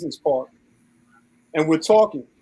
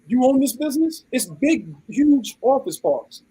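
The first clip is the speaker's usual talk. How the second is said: incredulous retort; 7 semitones higher, slower than usual. Retold or imitated. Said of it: "And I said".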